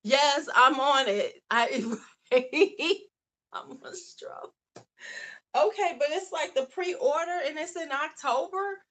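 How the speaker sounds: giddy over the palette; excited shout. Real, not acted. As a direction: burst out with glee. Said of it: "excited about the palette".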